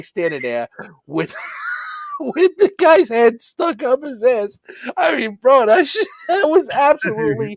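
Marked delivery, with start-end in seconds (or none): 1.8-7.6 high-pitched, laughing to tears; helpless laughter. Real, not acted. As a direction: barely get the words out for laughing. Cracking up at favourite scene.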